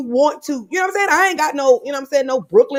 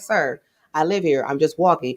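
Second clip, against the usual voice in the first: low, calm imitation of a reasonable man; mock-polite, level-headed reply; sensible voice; 9 semitones lower, 4 dB quieter than usual. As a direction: drop it low and explain politely. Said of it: "talking to you such a sensible voice".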